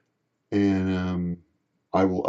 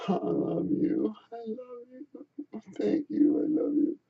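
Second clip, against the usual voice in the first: very soft, whispery murmur; slow, fading whimper of affection; imitated drunken endearments; 13 semitones higher, 11 dB quieter, slower than usual. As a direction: murmur it faintly, as if barely conscious.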